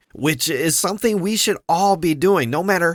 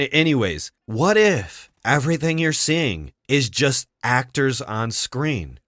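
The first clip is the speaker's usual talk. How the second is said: ordinary delivery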